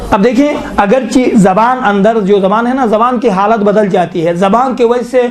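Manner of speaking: ordinary talk, nothing marked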